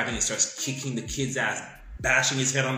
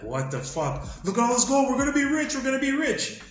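much higher and louder, giddy; gleeful high yelling over the gold; excited greed; 5 dB louder than usual. Retold or imitated. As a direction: shout it like you just struck it rich. Men find gold, overjoyed.

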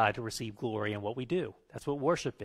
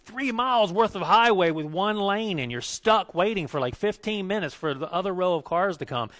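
rising into a loud, higher, exasperated rant about the road work; real rant; 7 semitones higher, 6 dB louder than usual. Real, not acted. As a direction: vent the traffic frustration, getting louder. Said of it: "I'm ranting".

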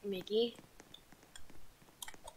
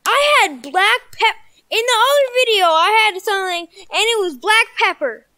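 distressed; 8 semitones higher, 9 dB louder than usual. Real, not acted.

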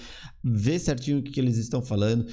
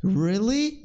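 high rising surprised Really?; 8 semitones higher than usual. Shown as a demonstration. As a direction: ask it like you can't believe it. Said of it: "Olha só a cara dela de surpresa".

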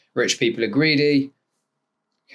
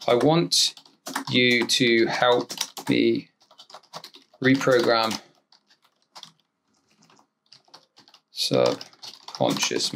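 slow dictation; 3 semitones lower, 4 dB quieter, much slower than usual. Acted. Dictating prompt to software.

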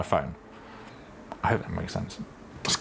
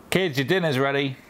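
raised calling voice; 6 semitones higher than usual. Shown as a demonstration. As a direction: call the kids to dinner. Calling kids to dinner.

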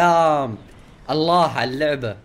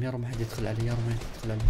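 soft, tense murmuring to himself; hushed nervous prayer; 6 semitones lower, 11 dB quieter, much faster than usual. Real, not acted. Gamer hiding in a building, praying softly. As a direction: beg quietly that nobody walks in.

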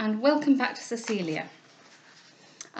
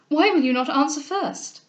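higher, insistent question; agitated demand in a raised voice; crying out; 5 semitones higher, 4 dB louder than usual. Acted. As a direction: cry out impatiently. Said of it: "cried she".

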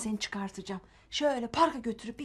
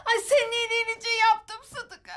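high, fraught excuse; high-pitched motherly wail; wailing self-justification; 9 semitones higher, 3 dB louder than usual. Acted. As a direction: plead your good intentions. Mother wailing excuses after hitting.

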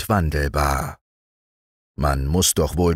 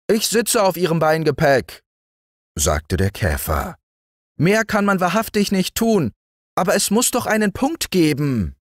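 high beetle voice, mildly fussed; fussy beetle; 11 semitones higher than usual. A narrator's reading. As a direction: protest as the beetle. Beetle replying.

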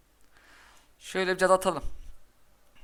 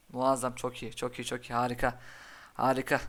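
usual speaking voice

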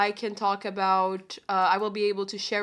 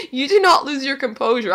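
ordinary talk, nothing marked

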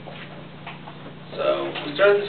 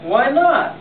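innocent Wilbur question; 9 semitones higher, 3 dB louder than usual. Acted. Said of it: "asked Wilbur".

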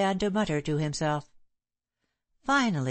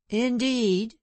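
sneering; 5 semitones higher than usual. A narrator's reading. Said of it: "sneered Franklin".

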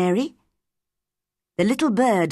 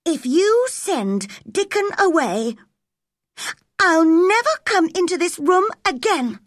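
angry; 10 semitones higher, 3 dB louder than usual. A narrator's reading. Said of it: "Mary suddenly became very angry".

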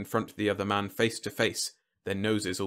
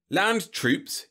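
higher-pitched confident pronouncement; 9 semitones higher than usual. A narrator's reading. General pitching his plan, confident. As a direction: announce it with complete assurance. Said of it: "he had said confidently".

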